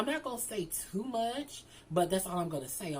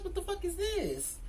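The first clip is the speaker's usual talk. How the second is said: high mock-shocked question; incredulous; 11 semitones higher than usual. Arriving at the wrong complex, high mock disbelief. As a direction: retell the shock, pitched high. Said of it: "I'm like".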